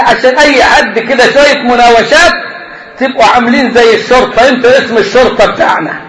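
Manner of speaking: ordinary talk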